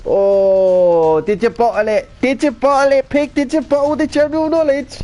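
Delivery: high, loud playful taunt; mock teasing. Real, not acted. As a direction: needle him about bottling it, pitch climbing. Teasing the Pig about bottling.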